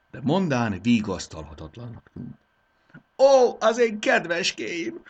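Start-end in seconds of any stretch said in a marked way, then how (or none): 3.2-5.0 high, loud, slow wail over lost treasures; sobbing. Acted. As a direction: break into a loud, slow lament. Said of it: "zokogott fel még hangosabban".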